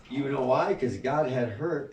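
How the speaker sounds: ordinary delivery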